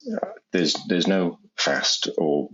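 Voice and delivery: ordinary talk, nothing marked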